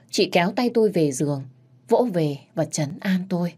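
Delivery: plain reading voice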